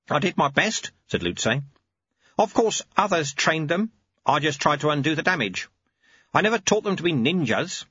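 put-on wry, patient old teacher; old monk Lutze. Lutze replies.

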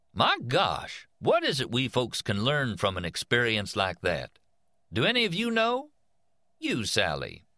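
high, slow, friendly folksy teacher; affable teacher voice. A narrator's reading. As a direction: chat with the kids, easygoing. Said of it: "in an affable, informal voice".

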